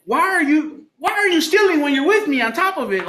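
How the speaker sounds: high, incredulous retold telling-off; exasperated scolding. Man scolding woman for stealing beside him, exasperated. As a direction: act out pleading outrage with pitch way up.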